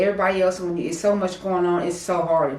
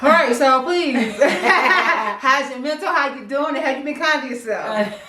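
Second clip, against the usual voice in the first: laughing; 7 semitones higher, 6 dB louder than usual. Real, not acted. Laughing while asking the audience.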